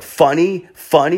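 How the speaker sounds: high, rising mock question; taunting challenge. Drunk man confronting the laughing French family, taunting. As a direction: needle them with a high, upturned jab.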